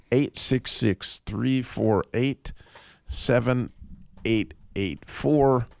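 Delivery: spelled out slowly, digit by digit; slow and clear for callers; slow, deliberate number. Real, not acted. Reading out the call-in number.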